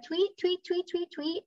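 bird tweets. Retold or imitated. Mama bird calling babies.

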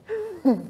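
high, rude little laugh; sniffing mock laugh. A narrator's reading. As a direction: scoff at her rudely. Boots mocking the barmaid.

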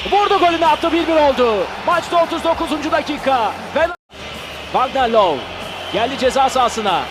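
usual speaking voice

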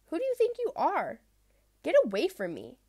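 indignant high snap; angry. Acted. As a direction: drive the little fish away. Proud fish rejects little fish.

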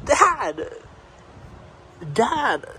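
high, nagging call; high-pitched pestering; insistent calling. Real, not acted. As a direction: call high to rouse dad. Calling sleeping dad.

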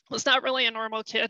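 ordinary talk

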